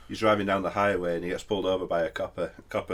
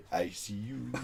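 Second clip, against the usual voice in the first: laughing whisper; 10 dB quieter, slower than usual. Real, not acted.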